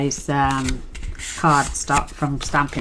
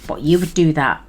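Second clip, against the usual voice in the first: ordinary talk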